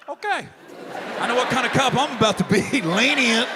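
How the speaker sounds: loud laughing delivery; laughing loudly. Real, not acted. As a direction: laugh through the punchline.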